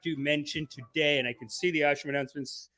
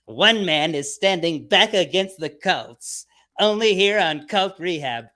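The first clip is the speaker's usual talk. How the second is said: trailer narrator; 6 dB louder, slower than usual. Acted. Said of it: "it's like".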